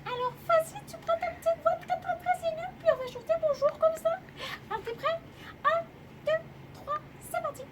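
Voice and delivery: tiny, very high squeak; squeaky little mouse giving instructions; baby mouse voice. Shown as a demonstration. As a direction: talk as high as you can, like a baby mouse. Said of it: "petit bébé ou d'un petit bébé souris".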